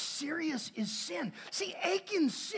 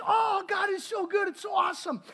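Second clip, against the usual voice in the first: high, bright exclamation of joy; excited cheer; 7 semitones higher, 3 dB louder than usual. Retold or imitated. Preacher voicing the people, mock joyful cheer. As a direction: cheer like the thankful crowd.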